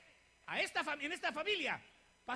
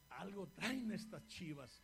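low, soft, slow knowing murmur; soft slow suspicious aside; 6 semitones lower, 8 dB quieter, slower than usual. Retold or imitated. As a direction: mutter it as a wary pastor. Pastor's private suspicious thought.

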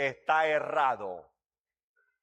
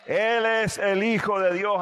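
preaching shout; 3 semitones higher, 5 dB louder than usual. Acted. Preacher repeats it, rising.

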